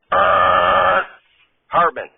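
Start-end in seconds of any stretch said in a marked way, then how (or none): 0.1-1.0 long monotone "errr" like a buzzer; droning voiced buzz on one note; wrong-answer buzzer. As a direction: imitate a game-show buzzer.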